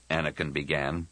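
ordinary delivery

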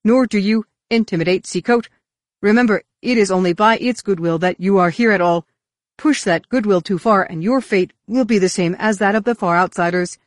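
loud, forceful warning; threatening. A narrator's reading. Roel defending Seacoat against visitors, loud threat. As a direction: warn them with rising indignation.

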